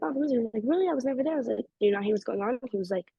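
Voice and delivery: normal talking voice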